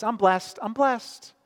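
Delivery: high, bright sing-song "I'm blessed"; sing-songy voice. Retold or imitated. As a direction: chirp it like forced cheer. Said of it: "throw it off in a sing-songy voice".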